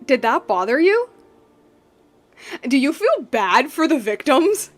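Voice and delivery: normal talking voice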